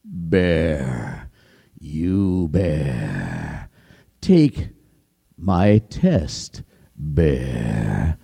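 deep, slow menacing lion voice; low, drawn-out royal lion; lion king. Acted. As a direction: growl the test like a hungry king. Lion calls the bear.

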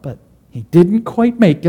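ordinary delivery

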